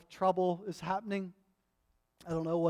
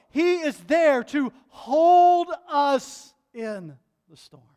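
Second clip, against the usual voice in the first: loud, high, slow preaching climax; rising sermon fervour, slowed for weight; full-force preaching; 10 semitones higher, 11 dB louder than usual. Acted.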